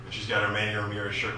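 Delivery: ordinary talk, nothing marked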